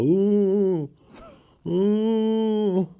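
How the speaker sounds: put-on groaning old man. Sick old roommate groaning.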